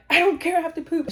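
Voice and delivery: loud, high retold protest; desperate insistence. Wife overruling husband before the appointment, desperate.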